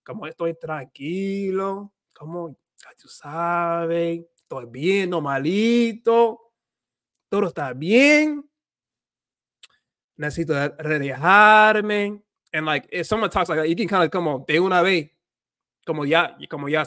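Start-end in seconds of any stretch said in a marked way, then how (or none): 0.0-8.4 long drawn-out vowels, slow and relaxed; Dominican drawl. Shown as a demonstration. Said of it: "I elongate, like, the words". 10.2-12.2 Dominican drawl. Shown as a demonstration. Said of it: "I elongate, like, the words".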